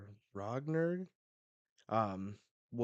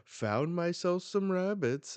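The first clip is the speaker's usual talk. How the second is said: high, loud, drawn-out gloat; hammed-up hunter's taunt; 10 semitones higher, 9 dB louder than usual. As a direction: crow over your catch. Voicing hunter finding the family.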